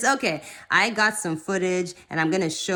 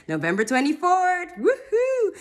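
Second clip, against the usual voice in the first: excited announcement; 12 semitones higher than usual. Real, not acted. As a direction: pitch climbing into a high whoop. Announcing her air date with glee.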